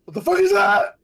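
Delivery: high, loud, terrified; terrified shout. Real, not acted. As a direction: shriek at the monster. Sees huge monster.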